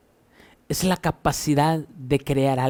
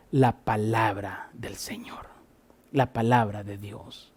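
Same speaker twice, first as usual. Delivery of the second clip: hushed whisper; 5 semitones lower, 8 dB quieter than usual. Acted. Hushed scripture close.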